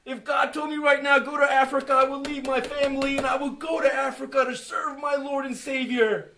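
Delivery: loud, rising testimony; impassioned church testimony; fervent preaching. Real, not acted. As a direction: preach it out with full conviction. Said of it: "testimony rising to preaching".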